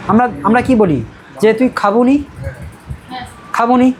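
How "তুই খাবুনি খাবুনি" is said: Medinipur dialect, saying "খাবুনি". Shown as a demonstration. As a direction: demonstrate Medinipur "খাবুনি", ending in -uni. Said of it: "শুধু মেদিনীপুরিয়ানদের".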